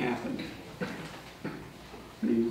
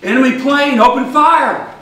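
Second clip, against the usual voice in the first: loud high yell of alarm; shouting; 10 semitones higher, 12 dB louder than usual. Retold or imitated. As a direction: shout the warning again. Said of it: "The drunk says".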